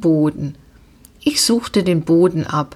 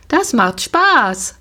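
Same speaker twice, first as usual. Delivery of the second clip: slow, eager and higher; 5 semitones higher, slower than usual. A narrator's reading. Girl urging her friend to ride, eagerly. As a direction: sell the fun with eager delight. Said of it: "sagte Paula voller Eifer".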